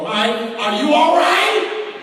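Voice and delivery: high, loud, slow call through a door; shouting through the door. Retold or imitated. Student banging on the dorm door, calling out loud. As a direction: call out to him, worried.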